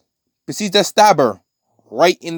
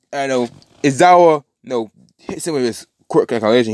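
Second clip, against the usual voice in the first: ordinary talk, nothing marked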